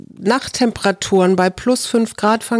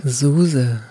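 low, drawn-out hotline announcer name; phone menu voice; 5 semitones lower than usual. Shown as a demonstration. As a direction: repeat it like a recorded phone greeting. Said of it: "Sag das nochmal".

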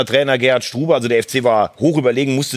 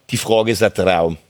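lightly imitated Austrian accent, pitched lower; 6 semitones lower than usual. Retelling the Cologne coach's answer about worry, Austrian accent. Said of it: "auf Österreichisch, kann das ... mal nachmachen".

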